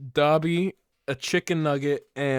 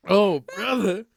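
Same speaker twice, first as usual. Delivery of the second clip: exasperated; 13 semitones higher, slower than usual. Acted. Exasperated mock.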